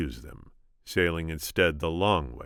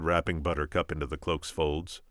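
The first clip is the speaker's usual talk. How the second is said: ordinary delivery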